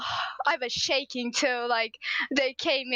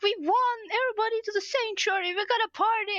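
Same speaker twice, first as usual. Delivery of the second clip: retold screaming; 6 semitones higher, faster than usual. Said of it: "literally screaming at the top of their lungs".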